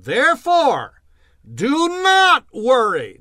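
slow, loud, drawn-out order; preaching command. Acted. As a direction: thunder it out as a command. Preacher reads command forcefully.

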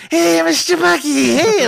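dad switching off the radio voice to greet his son; dad's playful greeting. Retold or imitated. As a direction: sing out a goofy hello to the kid. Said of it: "He looks over at me".

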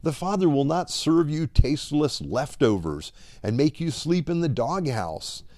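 ordinary talk, nothing marked

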